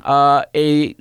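normal talking voice

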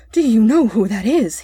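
usual speaking voice

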